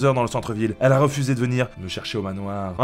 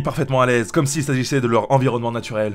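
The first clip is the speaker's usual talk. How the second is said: normal talking voice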